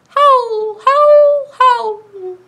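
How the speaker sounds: high loud wolf howls. Retold or imitated. Librarian howling like a wolf for the kids. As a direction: howl like a wolf. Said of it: "make a sound like a wolf".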